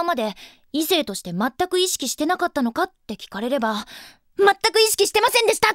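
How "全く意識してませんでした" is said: higher, fast, confident announcement; proud declaration. Acted. Answering a question about the friend, proudly emphatic. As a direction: declare it proudly, quick and high. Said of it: "胸を張って答えられる".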